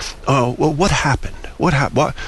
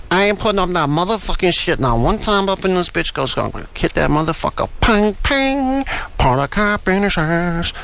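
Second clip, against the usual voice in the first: higher, put-on street slang voice, tough-guy swagger; mock a suburban kid faking a gangster voice, pitched up and swaggering; 8 semitones higher than usual. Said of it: "he's like this".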